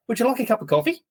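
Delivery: high, sugary offer of coffee; mock-sweet driver turning all friendly; suddenly friendly driver. Driver offering the tourist coffee, high mock-friendly voice.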